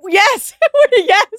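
high, loud, laughing exclamation; vindicated, high-pitched cheer; triumphant yes. Real, not acted. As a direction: crow it with delight. Vindicated over her regular pronunciation.

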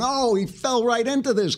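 high-pitched excited outcry; Shapiro. Retold or imitated. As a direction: retell a colleague's gleeful reaction. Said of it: "Shapiro's going".

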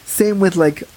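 ordinary delivery

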